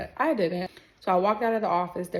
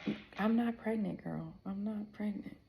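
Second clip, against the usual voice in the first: very soft, faltering at the start; stammer, then murmur it under your breath; hushed denial; 11 dB quieter than usual. Retold or imitated. Patient answering the nurse's repeated pregnancy question, hushed denial.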